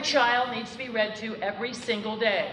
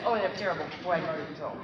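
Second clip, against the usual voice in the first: imitating a teacher; 6 semitones lower, 4 dB quieter, faster than usual. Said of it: "if you ask every kindergarten teacher".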